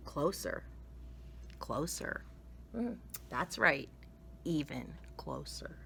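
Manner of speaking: quietly, down to a whisper; hushed. A narrator's reading. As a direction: draw in close, fade to a whisper. Mom leaning close to door.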